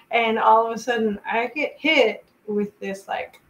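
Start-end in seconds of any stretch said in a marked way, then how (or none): none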